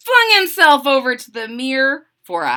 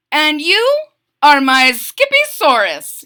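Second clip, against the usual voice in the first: louder, proud announcement; 5 semitones higher, 8 dB louder than usual. A narrator's reading. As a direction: announce it out loud. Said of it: "he added out loud".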